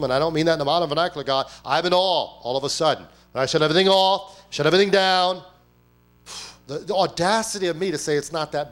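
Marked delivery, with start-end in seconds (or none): 3.3-5.4 loud, higher preaching build; rising preacher fervor. Acted. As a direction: drive it home with force. Preaching climax.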